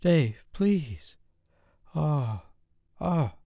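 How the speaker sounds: high, pleading woman's voice, sighing; pleading lover's voice; begging. A narrator's reading. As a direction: beg him breathlessly. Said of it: "begging for mercy".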